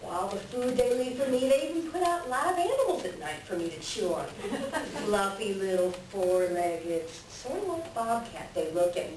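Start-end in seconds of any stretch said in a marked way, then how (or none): 4.3-5.0 short sly chuckle, rising; a quick smug trickster laugh; gloating chuckle. Acted. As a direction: chuckle at your own luck. Coyote gloats over easy food.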